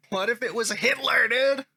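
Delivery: mildly worked-up commenter, pitch rising; a bit of emotion. Acted. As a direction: read the comeback with some sauce. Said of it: "He's got a little bit of emotion".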